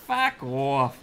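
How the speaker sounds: put-on Boston accent. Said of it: "Boston accent".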